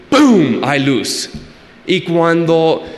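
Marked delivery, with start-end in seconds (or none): 0.1-0.6 a loud, higher "pum" like an impact; punchy spoken boom; mock boom. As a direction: slam the word down as a sound effect. Sound effect for Jesus' word.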